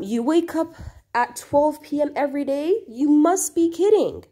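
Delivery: teacher acting out Ahmed's astonished exclamation; incredulous exclamation. Shown as a demonstration. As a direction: sound amazed that anyone sleeps till noon. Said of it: "Ahmed exclaimed".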